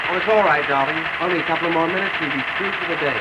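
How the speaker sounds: husband shouts to wife over hammering noise; shouting over noise. Acted. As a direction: yell to be heard above the racket.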